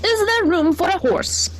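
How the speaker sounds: high horse voice asking; a horse eager to join; hopeful horse. A narrator's reading. Horse asks Henry for a spot, high.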